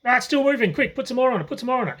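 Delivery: quick, pile the salt on, pitched high; urgent prodding. Retold or imitated. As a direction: grandfather mock-panicking to grandma. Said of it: "he's like".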